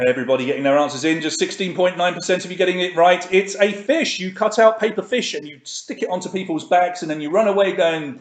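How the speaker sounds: ordinary delivery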